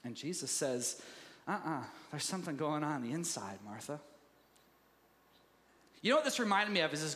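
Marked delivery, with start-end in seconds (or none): 1.5-4.0 low, soft, knowing reproof; quiet, calming voice of a teacher; gentle correction. Acted. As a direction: correct her softly, dropping to the name. Voices Jesus gently correcting Martha.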